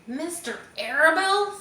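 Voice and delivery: high, stunned pig voice; shocked disbelief. A narrator's reading. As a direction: gasp it out in disbelief.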